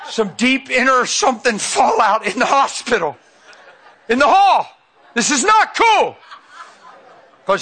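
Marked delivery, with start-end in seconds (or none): 0.1-6.2 loud, high, hammed-up dismay; comic exasperation. Dad facing a fallout in the hospital hall, hammed-up exasperation. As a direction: play up the father's alarm for laughs. Dad's alarm, played for laughs.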